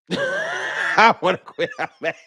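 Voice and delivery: high, slow, laughing through the words. Real, not acted. Fan laughing at his own frustration with the team, slow and laughing. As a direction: laugh out the complaint in mock despair.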